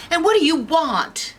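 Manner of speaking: high-pitched, startled demand; alarmed Goldilocks. Acted. Girl confronting three strange bears, high alarm. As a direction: ask it in a high panicky voice. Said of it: "And Goldilocks is like".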